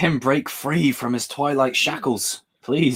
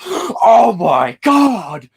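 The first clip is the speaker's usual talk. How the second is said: drawn-out awed gasp, much higher; mock gasping fan; 14 semitones higher, 5 dB louder, much slower than usual. Second type of trailer viewer, awestruck and gasping. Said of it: "the other one where it's like".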